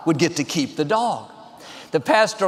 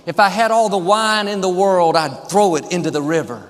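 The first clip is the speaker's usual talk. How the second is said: ordinary talk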